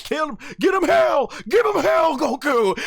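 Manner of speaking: high, loud cheering yell; cheering him on. Retold or imitated. Fan retelling yelling at the screen for Goku, loud and high. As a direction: urge Goku on like a rowdy fan. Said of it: "I'm like".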